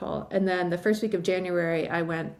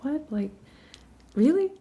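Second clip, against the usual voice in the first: stunned disbelief; 7 semitones higher than usual. Retold or imitated. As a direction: retell the moment the news hit. Shocked reaction to the news.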